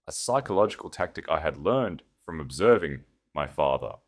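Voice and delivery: usual speaking voice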